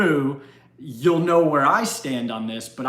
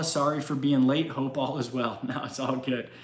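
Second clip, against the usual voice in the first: normal talking voice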